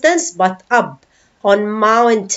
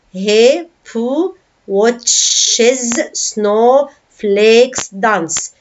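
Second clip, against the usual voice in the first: slow beats with a gap after each; syllable by syllable; much slower than usual. Shown as a demonstration. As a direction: count out the line one syllable at a time. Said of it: "You have here seven syllables".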